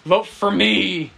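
loud, slow devil growl. Retold or imitated. Friend mocking her drawing in a devil voice. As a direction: ham up a demonic vote plea. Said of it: "her fucking devil voice".

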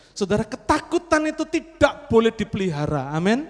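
loud, high pulpit declaration; preacher building to a loud amen; fiery preaching. Acted. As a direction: drive it home to the congregation. Preaching at full force.

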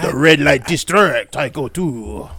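put-on slow caricature French accent; drawn-out stage-Parisian French accent. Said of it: "give me your best French accent".

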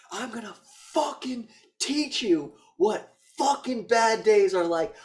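high, loud, slow villain rant; hammed-up shouted menace; mock furious threat. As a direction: punch out each word as a threat.

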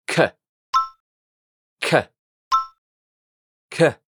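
K with a strong puff of air; aspirated k. Shown as a demonstration. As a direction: push extra air out on each K. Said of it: "expulsamos más aire".

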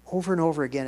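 normal talking voice

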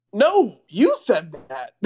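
slow, with "you" swooping up and stretched; drawn-out protest, "you" emphasized; stress on "you". Retold or imitated. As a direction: throw the blame back by leaning on "you". Retold argument over who said it.

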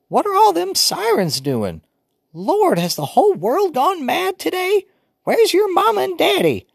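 put-on high, loud old woman fussing; fussy grandmother scolding; old grandma voice. A narrator's reading. Grandmother woken early, fussing at the noisy children.

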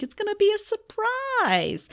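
high, slow teasing lilt; a slow, high sing-song tease; teasing singsong. Real, not acted. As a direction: dangle the surprise playfully. Teasing next episode.

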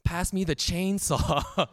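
high, slow mock mom voice; lightly voiced mom, stretched out; imitating mom. Friend mocking John's mom in class, high and slow. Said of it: "imitating my mom now".

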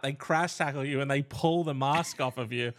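normal talking voice

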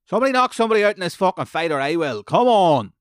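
frustrated shout. Real, not acted. Fed-up fan yelling at a boring fight, furious shout. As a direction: bellow it at a dull fight.

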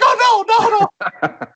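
squealed high, loud "oh no"; mock panic. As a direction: pretend terror, very high. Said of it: "we're like".

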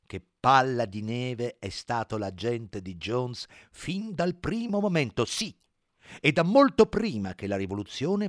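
very slow, firm and deliberate. Acted. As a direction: lay down each word slowly. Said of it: "parlando molto lentamente e con fermezza".

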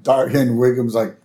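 normal talking voice

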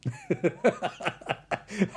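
laugh. Real, not acted.

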